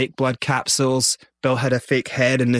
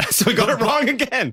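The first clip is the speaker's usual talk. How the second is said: high voice breaking into laughter; laughing; 11 semitones higher than usual. Real, not acted. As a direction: laugh your way through the admission. Laughs at their botched answer.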